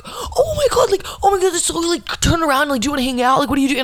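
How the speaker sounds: very high-pitched, gushing mock excitement; over-energetic friend. Friend spotting her friend drive by on a walk, mock gushing excitement. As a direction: squeal it like an overexcited friend. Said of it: "when people have so much energy".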